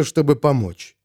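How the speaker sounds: ordinary delivery, nothing marked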